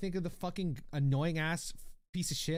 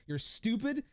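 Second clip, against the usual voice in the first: mock insult; 7 semitones higher, slower than usual. Streamer teases the chatter, repeating the insult higher in mock scorn. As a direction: throw it as a playful jab.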